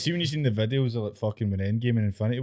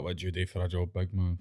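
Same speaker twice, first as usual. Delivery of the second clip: mild mock crowd work; 3 semitones lower, 5 dB quieter than usual. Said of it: "interact like".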